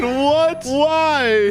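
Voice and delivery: high-pitched mock panic; panicked child. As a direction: cry out like a scared kid. Voicing the panicking kidnapped child.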